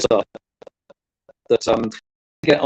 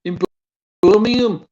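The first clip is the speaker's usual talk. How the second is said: high-pitched Brummie lilt; Brummie accent; 10 semitones higher than usual. Shown as a demonstration. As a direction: say it like a Birmingham local, pitched up. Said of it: "in a Bromy accent".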